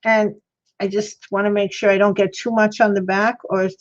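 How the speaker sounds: ordinary talk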